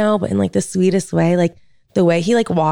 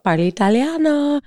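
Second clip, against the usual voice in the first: a touch of Italian lilt, pitched much higher; Italian phrase; 8 semitones higher than usual. Retold or imitated. Telling her friend she speaks Italian, playful Italian flair. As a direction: show off your bit of Italian, playfully. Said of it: "you know".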